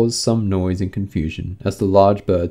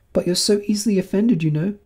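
put-on very high, girlish plea; Alice protesting her innocence; pleading; 11 semitones higher than usual. A narrator's reading. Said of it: "pleaded poor Alice".

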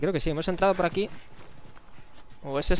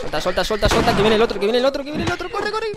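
rising panicked pleas; frantic urgent shouting; 5 semitones higher, 3 dB louder than usual. Real, not acted. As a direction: urge it out faster and higher. Enemy grabs him, another coming.

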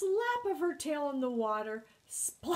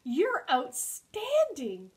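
higher, loud, drawn-out compliment; admiring; 4 semitones higher, 6 dB louder, slower than usual. A narrator's reading. Hedgehog returns porcupine's compliment, admiring and emphatic. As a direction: gush back, savouring the word slowly.

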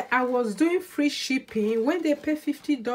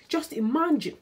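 ordinary talk

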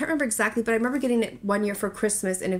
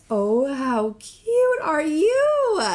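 cooing; 5 semitones higher, 3 dB louder, much slower than usual. Real, not acted. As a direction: coo at something adorable. Cooing at the figurine.

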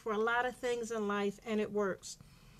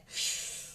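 long sharp breath out; big releasing sigh; venting exhale; 3 dB quieter than usual. Shown as a demonstration. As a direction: blow the anger out in one breath. Lets the vented anger out.

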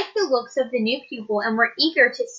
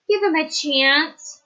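slow, earnest plea, pitched higher; pleading; 6 semitones higher, slower than usual. Acted. Sam begging the teacher for the swan, slow plea. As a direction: beg her gently to give him a chance. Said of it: "pleaded Sam".

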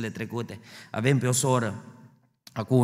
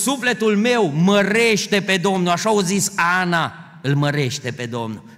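louder, higher preaching, climbing; full-force preaching; 6 semitones higher, 7 dB louder than usual. Acted. Preacher rousing the church about God's constancy, fervent loud preaching. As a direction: hammer the congregation with conviction.

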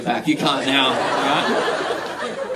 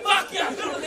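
mock Australian; 14 semitones higher than usual. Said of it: "it's like Australian Tourette's".

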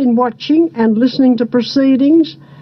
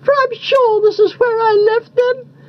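higher mother cuckoo voice; Missus Cuckoo; 9 semitones higher than usual. A narrator's reading. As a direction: lightly voice a cuckoo, pitched high. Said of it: "said Missus Cuckoo".

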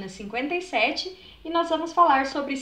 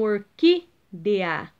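"orquídea" slowly split, "quí" stronger than the rest; antepenultimate stress; 4 semitones lower, much slower than usual. Shown as a demonstration. Teacher repeating the example for pupils, stressing "quí" in slow "orquídea". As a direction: separate "orquídea" and lean on "quí". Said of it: "é a antepenúltima".

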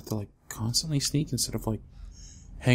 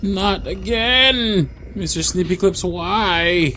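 loud, high, slow wail; anguished cry; 8 semitones higher, 10 dB louder, slower than usual. Real, not acted. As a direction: cry out at being caught again. Caught again by monster.

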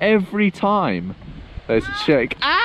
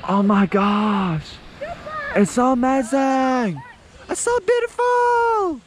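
mock awe; 10 semitones higher than usual. Mock awe after shot.

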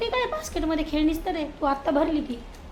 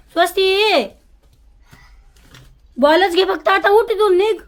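loud, higher orders to get up and leave; firm scolding command; 6 semitones higher, 9 dB louder than usual. Real, not acted. Mother orders child out.

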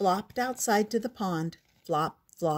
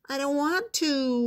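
whining; 7 semitones higher than usual. A narrator's reading. Froggy refusing to copy mother, whining. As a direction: drag your feet with a childish whine. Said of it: "whined Froggy".

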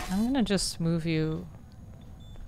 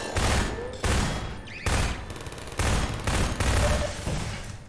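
airy high laugh after a scare; breathless laugh; 3 dB quieter than usual. Real, not acted. Startled Ah then laughs.

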